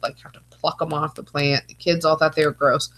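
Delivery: ordinary delivery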